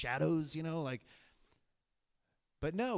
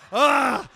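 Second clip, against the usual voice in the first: loud, raised "ah" of shock; loud shocked cry; 5 semitones higher, 14 dB louder than usual. Retold or imitated. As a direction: burst out with a big open yell. Mimics shock at the rewind.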